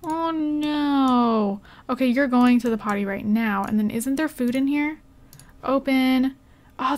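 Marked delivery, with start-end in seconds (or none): 0.0-1.6 high, pitying aww; drawn-out sympathetic oh; pitying coo. Real, not acted. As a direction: coo in sympathy for little ones. Pitying the game's poor toddlers.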